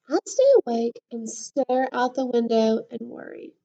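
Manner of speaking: lively storybook voice, big swings of pitch; reading to children. A narrator's reading. Teacher reading a book to her class, lively storybook voice. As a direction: read it to the class like a picture book.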